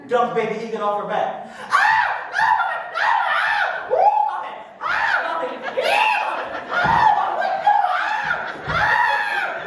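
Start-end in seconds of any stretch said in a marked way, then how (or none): 1.7-9.7 very high, loud screaming with laughter in it; panicked falling scream. Acted. Baby eagle falling from mama's back, screaming very high. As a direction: scream as you drop through the sky.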